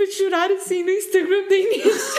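high, giggly telling; laughing. Real, not acted. Friend retells crying over Instagram, laughing. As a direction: laugh helplessly through the words.

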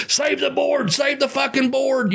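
high, frantic plea to save the board; mock frantic prison yell. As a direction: panic over the dominoes. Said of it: "Danny Trejo started going".

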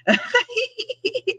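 high laugh catching into a cry; a teary high laugh; emotional laugh. Real, not acted. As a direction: laugh through tears. Moved, laughing about mom.

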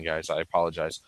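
normal talking voice

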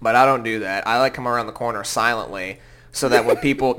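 ordinary talk, nothing marked

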